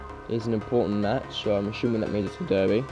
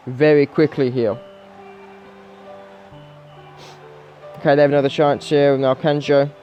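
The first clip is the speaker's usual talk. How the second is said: higher-pitched football commentary; live play-by-play; 5 semitones higher, 5 dB louder than usual. Real, not acted. Gamer calling his team's early concessions, live play-by-play. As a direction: call the match live as it unfolds.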